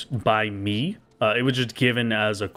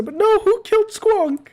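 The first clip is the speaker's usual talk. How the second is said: mock grief; much slower than usual. Real, not acted. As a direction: wail over a lost pet, laughing. Mourning the killed monster.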